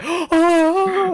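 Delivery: high, loud excited oh; excited gasp. Retold or imitated. Imitating Ronaldo fanboying over Undertaker, excited. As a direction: gasp like a starstruck fan. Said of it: "Cristiano Ronaldo is going like".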